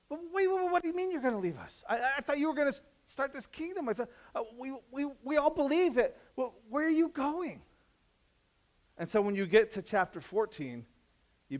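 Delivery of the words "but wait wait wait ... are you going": very high, rushed pleading; panicked disciples. Retold or imitated. Disciples ask Jesus where he is going, high and frantic. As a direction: beg in a mock panic. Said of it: "they're panicked".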